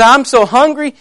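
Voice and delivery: grouchy hungry lion. Acted. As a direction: complain loudly as a hungry lion. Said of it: "And he was grouchy".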